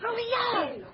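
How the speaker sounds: high called-out name; calling out. Acted. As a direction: call her over from across the bar. Ellie calls out across bar.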